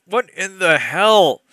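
drawn-out baffled exclamation; incredulous. Real, not acted. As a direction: reader baffled by the book, disbelieving. Disbelief at the text.

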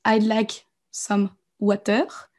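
slow, careful English sentence; slow example. Shown as a demonstration. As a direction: spell the sentence out for learners. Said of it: "la phrase est juste".

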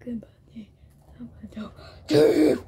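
usual speaking voice

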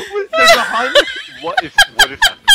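high, fast panicked yelp. Real, not acted. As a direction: blurt it out in panic. Panicked chase.

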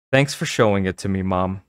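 plain reading voice

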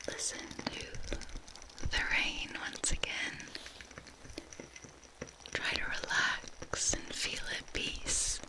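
calm reassuring ASMR whisper; soothing whisper. Acted.

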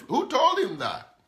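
high, loud rhetorical question; emphatic challenge. Real, not acted. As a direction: burst out with the question, pitch leaping up. Preacher breaks off scripture to challenge.

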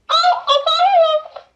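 talking doll voice. Shown as a demonstration. Girl demonstrating her toy's voice at the party, high squeaky doll. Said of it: "demonstrating toy voice".

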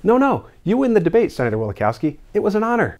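pitched high, suddenly enthusiastic convert; gushing agreement. Acted. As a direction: overact being won over. Senator-as-governor conceding gushingly.

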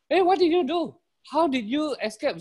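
high, loud disbelieving villagers; incredulous crowd demanding answers; astonished questioning. Acted. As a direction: pepper the rabbit with high-pitched questions. Villagers question returning rabbit.

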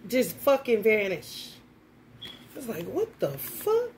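ordinary delivery

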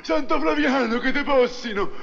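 high, startled exclamations; startled yelp. Acted. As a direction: jump at the hot foot. Kisses hot saint's foot.

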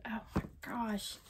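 whispered, deeply moved; awed whisper. Real, not acted. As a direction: whisper in quiet disbelief. Moved by the gift.

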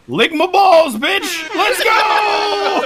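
high, loud gleeful yell; triumphant shout. Retold or imitated. Wife in a joke story, loud gleeful yell. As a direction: yell it like a winning punchline. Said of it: "and then she's like".

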